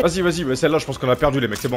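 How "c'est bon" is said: usual speaking voice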